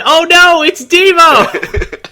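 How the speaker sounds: high, loud, drawn-out cry of alarm; mock shout. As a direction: shriek it slowly like a panicked villain. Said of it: "And then he shouted".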